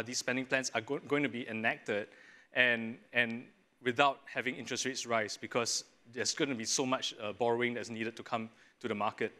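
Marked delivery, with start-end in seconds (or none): none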